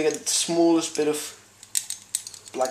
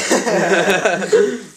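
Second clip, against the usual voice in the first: loud, high burst of laughter; high-pitched laughing fit; 5 semitones higher, 6 dB louder than usual. Real, not acted. Host laughs at friend's teasing, loud laughter.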